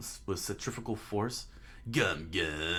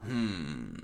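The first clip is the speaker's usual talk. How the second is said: usual speaking voice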